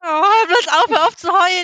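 loud, high teasing protest; half-laughing outcry to stop; excited protest. Real, not acted. Teasing friend who starts crying.

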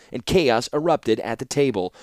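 ordinary delivery, nothing marked

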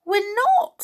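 put-on high child's protest. A narrator's reading. Niece denying it to her aunt, high protest. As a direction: squeak it out like a little girl. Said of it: "said Winnie".

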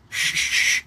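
hissed shushes imitating fries being dumped; repeated unvoiced "shh" noises; imitated rustle. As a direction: imitate food sliding out with sharp hisses. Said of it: "And I hear, like".

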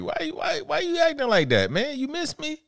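very high mocking voice; high-pitched caricature of the slave master teasing; imitating Leo's character. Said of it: "That's the one who was like".

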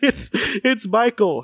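high, drawn-out cry with little volume; slow, high mock cry; mock scream. A narrator's reading. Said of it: "he screams".

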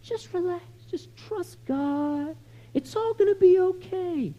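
very soft, high, drawn-out cooing; hammed-up gentle comforter, breezy platitudes; mock soothing reassurance. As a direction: soothe them in a sappy hushed singsong. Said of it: "doesn't just say".

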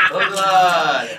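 drawn-out playful shout; calling out. Pretend. Joking call-out after a laugh.

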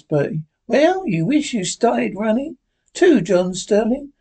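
ordinary delivery, nothing marked

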